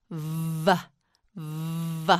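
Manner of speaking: "V" as a long buzzing vvv-uh; letter sound V. Shown as a demonstration. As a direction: hold the buzz of "V", then release. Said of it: "sounds like this".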